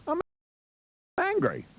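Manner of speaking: high-pitched, half-hearted show of anger; mock angry. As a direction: act out a token "I'm angry". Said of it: "tell yourself you're angry".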